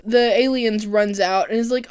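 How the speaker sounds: ordinary talk, nothing marked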